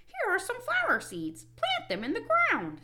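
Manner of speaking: high, loud, helpful frog; high-pitched storybook Frog; Frog character voice. A narrator's reading. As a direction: instruct Toad in Frog's high voice. Frog giving seeds to Toad.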